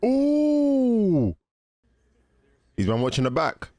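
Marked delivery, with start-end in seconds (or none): 0.1-1.4 long, high-pitched ooh; impressed "ooh". Real, not acted. Viewer reacting to the film, high drawn-out ooh. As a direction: hold a high ooh, then let it fall.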